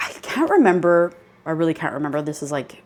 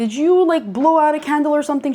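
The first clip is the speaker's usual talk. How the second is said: high, raised voice calling to another room; 9 semitones higher, 5 dB louder, much faster than usual. Retold or imitated. Calling to friend in other room, raised voice. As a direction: call over to a friend, pitched up and quick. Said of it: "I'm calling for her name".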